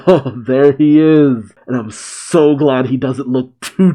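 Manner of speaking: plain reading voice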